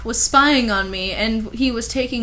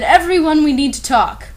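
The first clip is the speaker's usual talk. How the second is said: mild loud call; 5 semitones higher, 5 dB louder than usual. Acted. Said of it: "Hagrid ... said".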